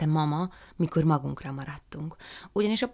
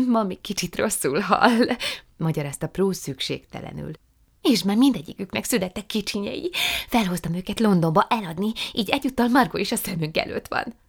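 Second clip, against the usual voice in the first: put-on higher, lively young woman; Prue's eager voice; 5 semitones higher, 5 dB louder than usual. A narrator's reading. Prue apologising to her aunt, high and eager. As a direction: apologise sweetly and brightly.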